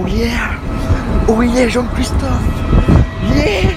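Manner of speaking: loud joyful yell, rising; excited whoop. Real, not acted. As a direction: cheer loud with real joy. Joie au décollage, cris. (cri de joie)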